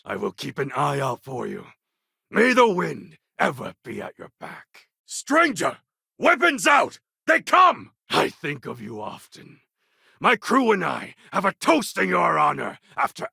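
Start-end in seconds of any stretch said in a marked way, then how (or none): 5.1-7.9 high, loud alarm calls; calling to arms. Acted. Companion warning the crew of attackers, loud alarm. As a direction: rouse the crew for a fight.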